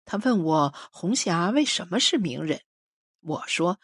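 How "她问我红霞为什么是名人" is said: ordinary delivery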